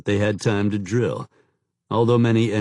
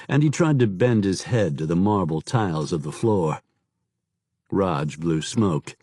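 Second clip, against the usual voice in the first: usual speaking voice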